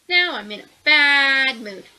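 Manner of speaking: loud, slow grumbling complaint; grumpy mole. Acted. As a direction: make the bad mood heard, slow and loud.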